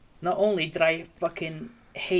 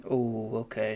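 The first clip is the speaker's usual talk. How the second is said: ordinary delivery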